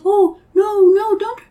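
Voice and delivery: high, loud Pooh Bear voice; pleading Pooh Bear. Acted. Pooh begs the creature to spare his friend, pleading.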